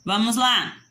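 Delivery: ordinary delivery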